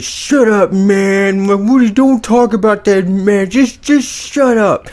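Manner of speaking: high, loud, mock-flustered guy; hammed-up high-pitched embarrassed yelp; embarrassed protest. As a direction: imitate a flustered dude squealing at his friends to stop. Embarrassed guy silencing his teasing friends.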